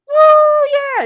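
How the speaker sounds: very high, loud woo yeah; imitating Dara's thrilled whoop; excited whoop. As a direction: whoop like the winner you are. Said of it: "And she's all excited".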